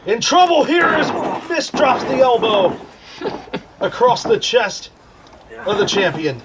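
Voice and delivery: excited ringside play-by-play call; wrestling commentator. Acted. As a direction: call the match like a ringside announcer. Play-by-play over wrestling game.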